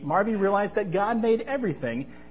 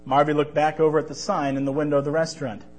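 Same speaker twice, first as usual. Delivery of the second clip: ordinary delivery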